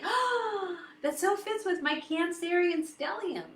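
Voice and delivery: high gasp of recognition, re-enacted; delighted realisation. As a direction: act out a thrilled discovery.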